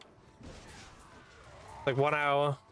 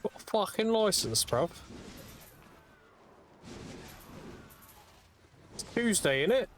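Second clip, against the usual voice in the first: mock British accent; 6 semitones higher, 3 dB quieter than usual.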